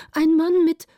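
high, loud, breaking off; frightened report cut short; alarmed. A narrator's reading. Frightened lookout reports what she sees. (erschrocken, laut und hoch)

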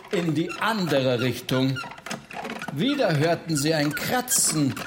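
plain reading voice

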